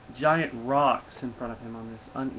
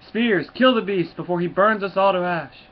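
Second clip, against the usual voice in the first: mock battle cry; 5 semitones higher, 5 dB louder than usual. Villagers urge killing the dragon.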